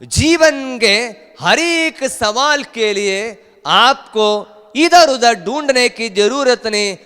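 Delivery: loud, high-pitched preaching cry; full-force preaching. Acted. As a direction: preach it out high and forceful, drawing words long. (ज़ोरदार, ऊँचे सुर में उपदेश)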